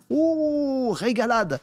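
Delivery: « Oh » lancé très haut, enthousiaste; s'extasier franchement; ravi. Real, not acted. S'extasie sur la fonction. (exclamation ravie et aiguë)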